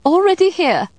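very high, loud sing-out; calling out. A narrator's reading. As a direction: announce yourself brightly from the door. Linda announces herself at door.

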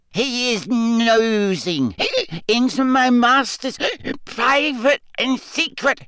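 very high, slow, hiccup-broken squeak; defiant tipsy elf refusing to tell; mutinous drunk elf. A narrator's reading. Winky defiantly refuses Harry, hiccuping.

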